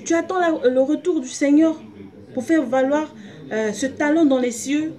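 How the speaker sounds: high, loud fervent preaching. Acted. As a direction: preach it out with fire. Preaching at full force.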